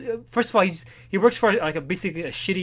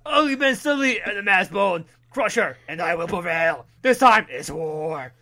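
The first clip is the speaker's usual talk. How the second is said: high, boastful trash talk; wrestler promo; 3 semitones higher, 6 dB louder than usual. Retold or imitated. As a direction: brag like a pro wrestler. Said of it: "it's a wrestling ad".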